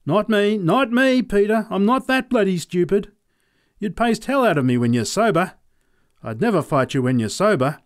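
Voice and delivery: high, nervous refusal voice; frightened farmer. Acted. Cowering farmer refusing to fight the sober man, high nervous voice. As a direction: back away from the fight in a high pleading voice.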